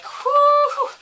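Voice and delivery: high, loud hooted whoo; exaggerated whoo. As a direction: let out a big high "whoo". Acting out sweating with relief.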